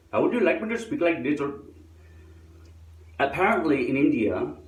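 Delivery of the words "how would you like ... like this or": higher, lilting mock Indian accent. Shown as a demonstration. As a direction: ask it in a put-on Indian accent. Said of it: "in an accent that you can understand".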